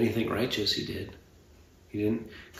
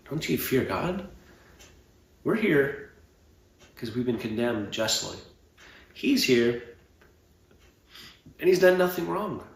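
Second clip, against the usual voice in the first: acted rebuke; 4 semitones higher, 3 dB louder than usual. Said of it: "The other one clearly understands the situation".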